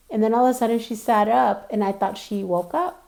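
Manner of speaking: ordinary talk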